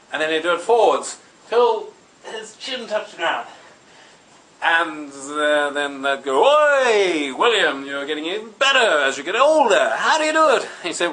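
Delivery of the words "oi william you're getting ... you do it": high, loud hail swooping up on the question; admiring crowd call. Acted. Fairgoers to William on his bending feat, admiring call-out. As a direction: call out as an impressed onlooker, pitched up.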